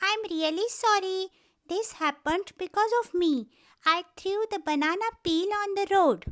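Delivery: put-on high child's voice, quick apology; sorry little rabbit. Little rabbit apologizing to the bear, high and quick. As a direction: blurt out the apology like a guilty kid.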